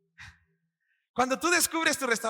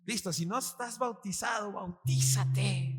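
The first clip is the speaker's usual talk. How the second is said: drawn-out urging; slower than usual. Real, not acted.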